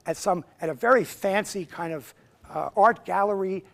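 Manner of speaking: ordinary talk, nothing marked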